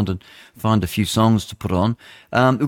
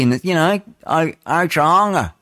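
ordinary talk

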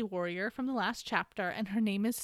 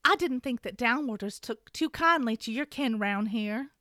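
a mild Southern belle read; Southern accent. Acted. Reading Shen Yun's line in a light Southern accent. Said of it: "Shen Yun is gonna be played by myself".

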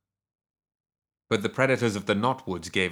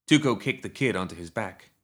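usual speaking voice

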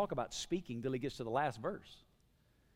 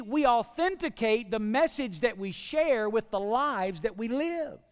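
high, loud, emphatic pulpit voice; impassioned preaching; 8 semitones higher, 8 dB louder than usual. Real, not acted. Preacher telling the church their whole life is sent, fervent. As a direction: press every phrase urgently on the congregation.

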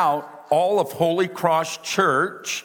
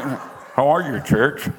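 side of the mouth; slower than usual. Shown as a demonstration. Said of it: "talked out of the side of my mouth".

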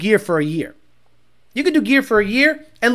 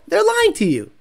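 mock warning; 8 semitones higher than usual. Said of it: "I'm gonna go".

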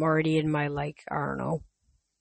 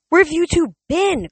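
demanding; 11 semitones higher, 6 dB louder than usual. Acted. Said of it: "Clarice demanded".

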